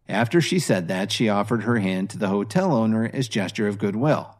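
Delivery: ordinary delivery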